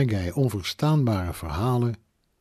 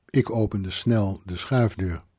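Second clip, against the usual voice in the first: ordinary delivery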